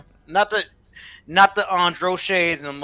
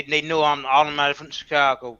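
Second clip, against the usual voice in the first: ordinary talk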